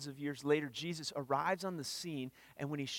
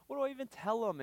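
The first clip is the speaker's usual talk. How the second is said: pitched-up, worried question; anxious Moses; 10 semitones higher, faster than usual. Retold or imitated. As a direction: voice Moses' panic, rising high.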